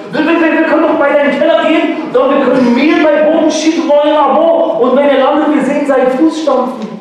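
high, fast stammering rush; excited kid. Acted. Kalle pitching a plan to the class, high and fast. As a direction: tumble over your own words.

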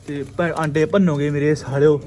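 loud scolding curse. Real, not acted. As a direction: tell the birds off loudly. Scolding pigeons for breaking eggs. (ਉੱਚੀ ਝਿੜਕ)

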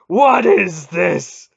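loud, high, drawn-out outrage; furious god bellowing; roared demand. A narrator's reading. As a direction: demand it slowly at full volume. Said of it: "the god demanded".

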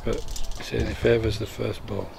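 ordinary talk, nothing marked

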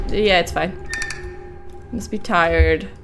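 ordinary talk, nothing marked

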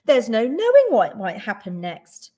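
loud, urgent plea; crying out in concern. A narrator's reading. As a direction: plead with concern, starting high. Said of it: "she cried".

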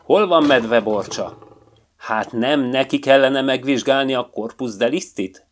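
the lion king demanding order; firm booming voice. A narrator's reading. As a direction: speak with regal authority. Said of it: "határozott, dörgő hang".

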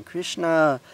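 high, loud wail of the name; despairing cry. A narrator's reading. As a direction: cry out as if for rescue. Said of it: "It was a despairing cry".

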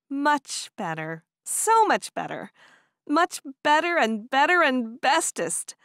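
giggly delight. A narrator's reading. Woman comparing puppy to roses, increasingly giddy. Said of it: "she giggled".

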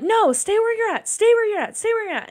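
loud, high-pitched panicked commands; freaking out, retold. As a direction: call out frantically to stop a toddler in danger, reenacted. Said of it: "Because I'm freaking out".

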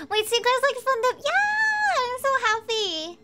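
high, loud cartoon voice; character voice. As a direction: squeal it like a giddy cartoon kid. Reading game character lines aloud.

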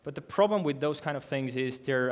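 ordinary talk

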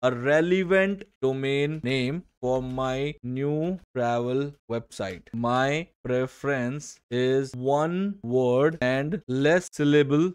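very slow, word-by-word reading of a typed prompt; spelling out a ChatGPT prompt slowly; slow dictation. Shown as a demonstration. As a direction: dictate each word as if typing it. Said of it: "चैट GPT में जाओगे और बोलोगे".

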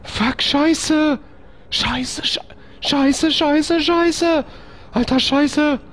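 very high, frantic cursing; panisches Fluchen. Real, not acted. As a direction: swear repeatedly in a squealing panic. Panicked swearing under attack in game. (panisch und schrill fluchen)

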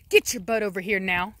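usual speaking voice

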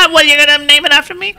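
high-pitched comic wife impression; Gracie. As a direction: squeal it in a put-on voice, far above your own. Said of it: "Then Gracie's like".

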